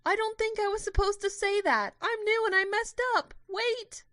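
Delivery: high, loud flustered call; calling after them. Acted. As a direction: shout after runaway tourists, flustered. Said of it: "She called after them, distracted".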